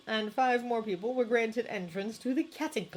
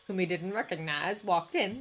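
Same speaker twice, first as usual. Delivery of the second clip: ordinary delivery, nothing marked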